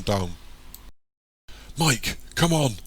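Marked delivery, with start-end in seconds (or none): 1.8-2.8 high, urging call; mildly raised voice, pitched up; urgent call. A narrator's reading. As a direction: call out to hurry someone along. Calling friend amid chaos.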